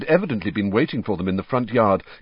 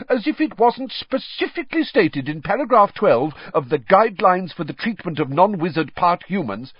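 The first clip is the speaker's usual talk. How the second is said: indignant Percy; 10 semitones higher, 3 dB louder than usual. Acted. Percy indignant about the reporter.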